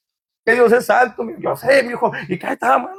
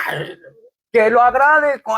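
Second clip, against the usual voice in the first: usual speaking voice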